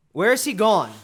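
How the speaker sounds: loud anxious demand; urgent demand. Acted. King demands where Hamlet went.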